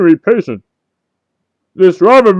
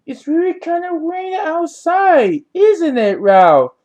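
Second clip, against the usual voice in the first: higher, sing-song mock of a friend's line; mimicking a friend's cheerful remark in a silly higher voice; mocking imitation; 7 semitones higher, 5 dB quieter than usual. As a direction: parrot him back in a teasing voice. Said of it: "I said".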